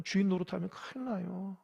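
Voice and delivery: ordinary talk, nothing marked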